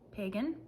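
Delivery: Pagan as PAY-gun, rising questioningly; alternative pronunciation attempt. Shown as a demonstration. Vlogger guessing an author's surname, Pagan as PAY-gun. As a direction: stress the first syllable of Pagan, like PAY. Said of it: "there's an accent over the second A".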